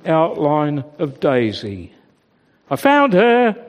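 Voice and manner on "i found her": calling out loud and high with relief. A narrator's reading. Shepherd calling out to his sons, glad and loud. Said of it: "the shepherd called to his sons".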